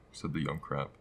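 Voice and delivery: ordinary delivery, nothing marked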